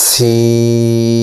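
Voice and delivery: জিম recited slowly and drawn out; letter demonstration. Shown as a demonstration. Teacher sounds the letter for students, slow and drawn out. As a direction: hold জিম long like Quran recitation. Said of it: "দাঁতের পেট ও আগার সঙ্গে লাগাইয়া".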